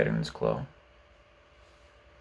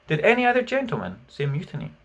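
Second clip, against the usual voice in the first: courteously; 6 semitones higher, faster than usual. A narrator's reading.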